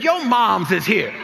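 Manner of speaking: slow, loud echo through laughter; laughing mock echo. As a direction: brush it off, laughing. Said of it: "I said".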